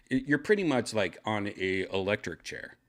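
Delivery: ordinary delivery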